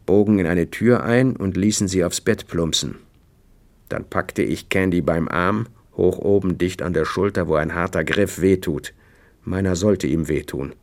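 usual speaking voice

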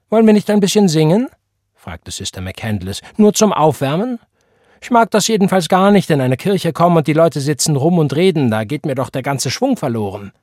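put-on high, loud preaching older church sister; stern church matron. A narrator's reading. Sister McCandless preaching to Elisha about lax youth, high and loud. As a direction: scold the neglectful youth with scripture, fervent.

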